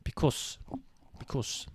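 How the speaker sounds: attempted South African twang on "because"; South African accent. Shown as a demonstration. Trying out mum's twang, South African accent. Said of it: "like, a twang of this accent".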